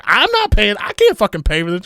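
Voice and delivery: high loud scolding; mom yelling. Retold or imitated. Mom telling son she can't pay, loud mock yell. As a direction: snap like a fed-up mother.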